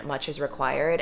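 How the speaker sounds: ordinary delivery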